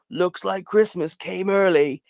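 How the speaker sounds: slow, sinister villain gloat. Retold or imitated. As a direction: draw it out like a creepy snowman killer. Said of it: "and he's like".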